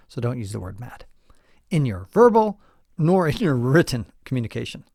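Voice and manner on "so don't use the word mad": whisper. Real, not acted.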